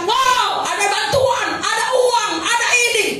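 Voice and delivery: high, hammed-up thrilled wow; mock excitement. Preacher mimicking people seeing aid and money, mock excited. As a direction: imitate someone thrilled by a handout.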